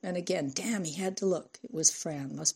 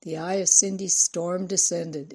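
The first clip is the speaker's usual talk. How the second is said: ordinary delivery, nothing marked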